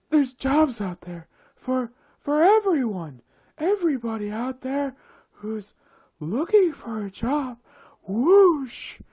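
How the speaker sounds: high airy mock-preachy voice with whooshes; mocking windy optimist. Ranting about airheads, mocks their breezy advice in a high voice. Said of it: "these really windy individuals".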